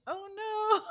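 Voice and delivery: mock dismay. Friend finding a borrowed book battered, mock dismay. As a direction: play up the dismay, half laughing.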